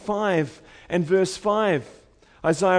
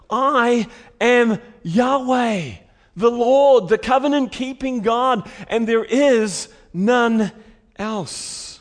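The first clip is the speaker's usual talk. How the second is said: emphatic proclamation; 3 semitones higher, 3 dB louder, slower than usual. Acted.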